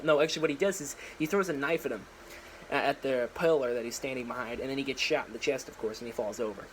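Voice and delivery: ordinary delivery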